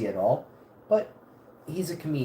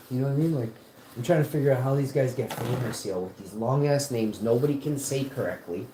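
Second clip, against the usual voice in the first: ordinary talk, nothing marked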